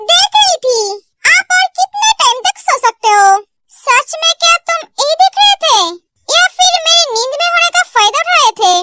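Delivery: lightly voiced huffy heroine; indignant young heroine. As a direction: question him with playful suspicion. Plays teasing, accusing woman.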